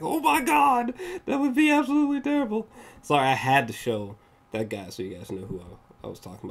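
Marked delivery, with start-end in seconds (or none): 0.0-2.7 higher, fast mock-shocked reaction; mock horror. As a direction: act out fake dismay, pitched way up. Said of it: "I was like".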